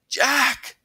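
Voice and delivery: high, loud call; sharp searching shout; yelled name. A narrator's reading. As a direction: yell to find a friend in the dark. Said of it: "I yelled".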